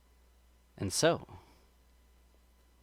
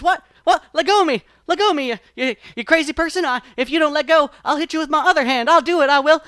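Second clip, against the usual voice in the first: higher, louder frantic rabbit yelling to be let go; panicked rabbit; 11 semitones higher, 11 dB louder, faster than usual. A narrator's reading. Brer Rabbit, hand stuck, yells at the sap person in panic. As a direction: flail and shout, stuck and panicking. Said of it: "his hand is stuck".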